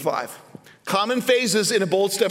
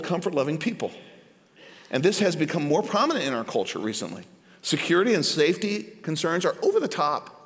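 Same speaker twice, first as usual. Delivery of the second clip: normal talking voice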